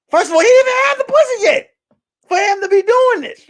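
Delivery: high, loud, laughing outburst; laughing shout. Real, not acted. Telling the live viewers about the thermal, laughing shout.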